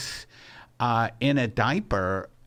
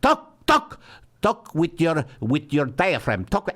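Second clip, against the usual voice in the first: put-on French accent; 3 semitones higher than usual. Imitating his Paris teacher at a lesson, French accent. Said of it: "he would use a French accent".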